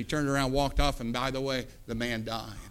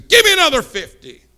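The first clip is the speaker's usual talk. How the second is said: high, loud royal yell; angry king's order; 10 semitones higher, 9 dB louder than usual. Acted. King demanding yet another fifty, angry shout. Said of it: "the king says".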